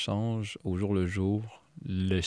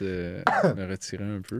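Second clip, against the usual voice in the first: normal talking voice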